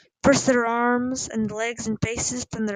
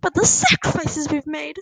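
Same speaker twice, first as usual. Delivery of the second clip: raised, emotional lament; wobbling with emotion; 5 semitones higher, 4 dB louder than usual. A narrator's reading. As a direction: lament as a wounded mother. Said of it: "Her voice wobbled with emotion".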